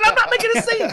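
high, loud, laughing denial; laughing protest. Retold or imitated. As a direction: squeal it out through laughter. Protesting to wife mid-class.

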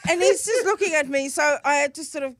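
normal talking voice

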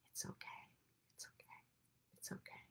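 soft reassuring whisper; whispered comfort. Shown as a demonstration. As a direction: whisper it to calm a crying baby. Soothing demonstration.

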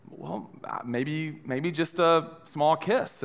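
soft, hesitant little offer; hesitant wife. Retold or imitated. Wife softly suggests a small goodbye kiss. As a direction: hedge it shyly and softly. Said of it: "she was like".

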